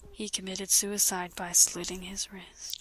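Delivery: whispered, breathy report; hushed news. Acted. Whispering the death of their friend.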